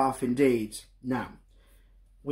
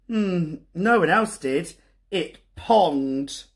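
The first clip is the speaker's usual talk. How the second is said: ordinary delivery, nothing marked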